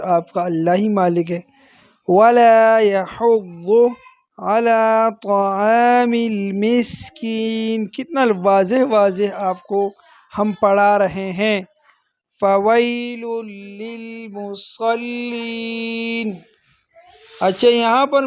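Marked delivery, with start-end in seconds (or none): 2.1-7.9 very slow melodic tajweed; measured Quranic chant; Quran recitation. Shown as a demonstration. 12.4-16.3 very slow melodic tajweed; measured Quranic chant; Quran recitation. Shown as a demonstration. Recites verse.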